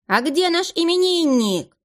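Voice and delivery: high, slow, drawn-out clown call; clown hollering. A narrator's reading. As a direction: call out for the birthday boy. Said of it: "голосил клоун". (клоун голосит)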